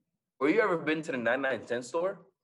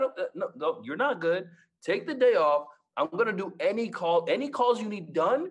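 high, loud eager offer to help; over-eager, pushing help on him; 7 semitones higher, 4 dB louder, faster than usual. Retold or imitated. Eagerly offering to cover calls.

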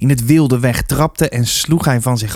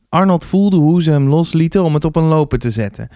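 ordinary delivery

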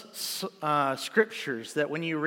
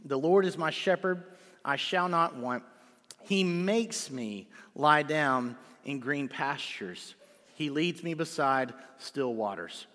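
scripture reading; 4 semitones lower, 3 dB quieter than usual. Acted. Pastor rereads the psalm to the church, soft and unhurried. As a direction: read verses one and two reverently. Reads verses one and two.

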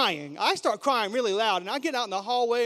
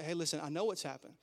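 much softer, lower, tender voice of God; God speaking tenderly to a hurting person; gentle reassurance; 5 semitones lower, 8 dB quieter, faster than usual. Retold or imitated. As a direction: comfort someone hurting. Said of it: "he would come up and he would say".

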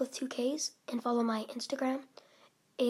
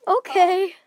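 embarrassed laughing; 6 semitones higher, 7 dB louder than usual. Real, not acted. Still reacting to the poem.

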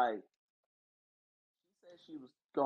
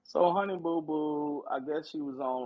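ordinary talk

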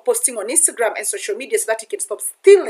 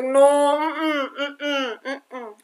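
high whimpering no, fading to a near sob; mock whining refusal; 5 semitones higher, much slower than usual. Mother hearing it's a girl, mock sobbing refusal.

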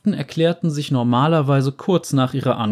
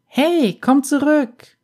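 high whiny pout; whiny spoiled pouty drawl; 13 semitones higher, 3 dB louder, slower than usual. Acted. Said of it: "schmollte sie".

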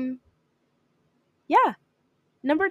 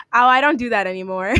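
amused reply; 4 semitones higher, 8 dB louder than usual. Retold or imitated. Quoting own reply, laughing after.

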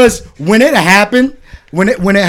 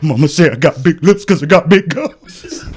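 Bubba; 2 semitones lower than usual. Retold or imitated. Quoting Bubba on the army bus, comic put-on voice. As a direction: mimic him through suppressed laughter. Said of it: "that motherfucker talking about it".

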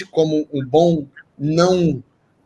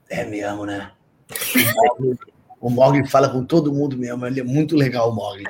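dreamy stoned voice; 6 semitones lower than usual. Retold or imitated.

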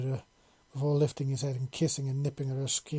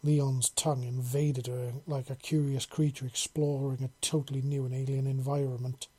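ordinary delivery

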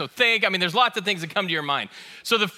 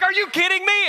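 much higher, louder mock wife voice; wife's disbelief; 12 semitones higher, 5 dB louder than usual. Wife mocks his driving claim, high incredulous. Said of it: "And she's like".

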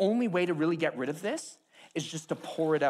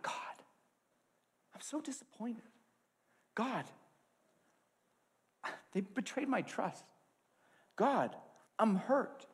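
much softer, broken pleas to God; hushed hurting prayer; 5 semitones higher, 6 dB quieter than usual. Retold or imitated. Believer softly pouring out hurt to God. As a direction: pray it softly, wounded. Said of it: "we be real with God".

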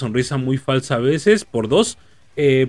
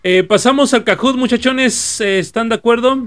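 excited announcing; 8 semitones higher, 4 dB louder than usual. Real, not acted. Host kicks off the quiz.

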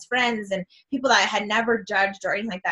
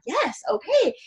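excited; 11 semitones higher, slower than usual. Retold or imitated. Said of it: "And I was like".

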